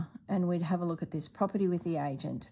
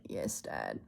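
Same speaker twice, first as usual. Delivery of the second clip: much lower, softer weary mutter; resigned mutter; 8 dB quieter than usual. Retold or imitated. Answering Dad on the phone with weary resignation. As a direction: say it like you've given up.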